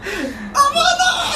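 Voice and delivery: very high squealing laugh; exaggerated, breathless-with-laughter noise; mock laughing fit. Acting out real amusement for friend, hammed-up high laugh. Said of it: "そんな笑い方するやつ".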